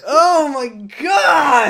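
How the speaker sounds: high, loud, slow oh my God; exasperated disbelief. Real, not acted. Reacting to the show's plot hole, loud slow disbelief. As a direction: stretch it in disbelief.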